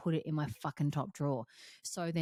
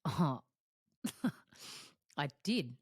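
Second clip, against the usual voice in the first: ordinary talk, nothing marked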